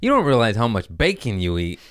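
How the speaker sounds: normal talking voice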